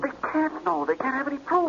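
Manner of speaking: high, shaken whisper of a cornered crook; panicked whisper. Acted. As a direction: hiss it out in rising panic. Crook hears cops know everything.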